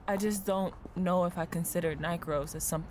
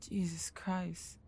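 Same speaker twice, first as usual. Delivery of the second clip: hushed and horrified, barely voiced; shocked whisper; 5 dB quieter, much slower than usual. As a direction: breathe it out in a stunned whisper, slow. Shocked reaction to grim news.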